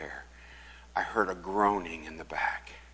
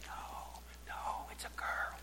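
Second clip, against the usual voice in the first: soft, unvoiced whisper; a hushed aside at the altar; whispered correction; 14 dB quieter than usual. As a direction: hiss it discreetly from behind. Same whisper repeated.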